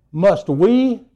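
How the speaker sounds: loud, indignant outburst with a sharp gasp, pitch up; huffy Moses. Retold or imitated. Moses angrily rebuking the complaining people, loud and huffy. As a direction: snap at the crowd, offended they doubt you. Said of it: "he gets all huffy".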